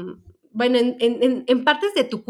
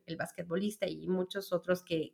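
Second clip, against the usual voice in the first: ordinary talk, nothing marked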